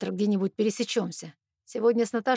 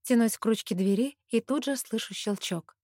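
usual speaking voice

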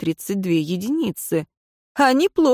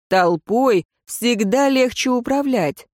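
ordinary delivery

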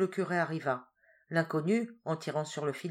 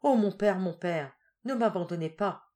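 pleading cry; 3 semitones higher, 4 dB louder than usual. A narrator's reading. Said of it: "s'écria Rose en se jetant aux pieds".

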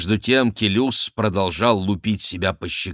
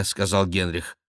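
usual speaking voice

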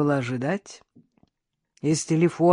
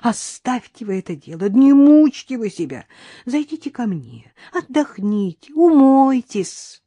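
high-pitched coaxing little man; fussy neighbour; 7 semitones higher, 5 dB louder than usual. A narrator's reading. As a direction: coax her in a higher, fussing voice. Fussing bald neighbour coaxes Karina. (суетливо уговаривая, высоким голосом)

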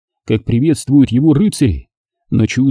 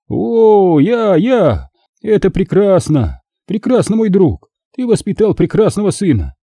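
high-pitched admiring exclamations; delighted magister; 7 semitones higher than usual. Acted. Magister praising his friend for raising such a son, delighted. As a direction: praise with rising enthusiasm, voice pitched up.

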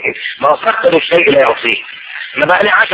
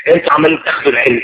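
ordinary talk

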